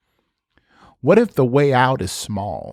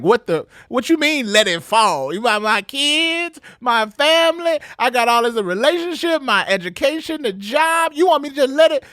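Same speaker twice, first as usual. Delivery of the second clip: high squeaky indignant woman, much higher; mock shocked churchgoer protesting in a high voice; incredulous woman; 13 semitones higher than usual. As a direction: play an outraged listener, pitched way up. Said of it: "She's like".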